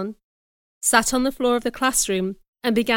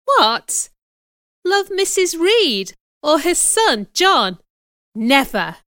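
high, loud, incredulous questions; indignant disbelief; 7 semitones higher, 5 dB louder, slower than usual. Acted. Girl told to love her enemies, loudly indignant. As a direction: reject the very idea, loud and scandalised.

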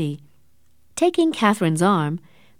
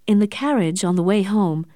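ordinary delivery

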